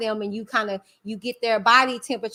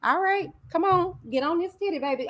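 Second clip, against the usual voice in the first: mock come-on; 7 semitones higher than usual. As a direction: play it as a joking invitation to the baby. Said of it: "And I'm like".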